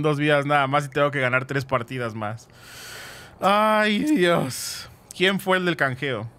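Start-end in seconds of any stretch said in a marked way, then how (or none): none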